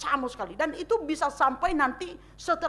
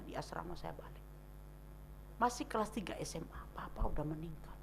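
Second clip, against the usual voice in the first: ordinary delivery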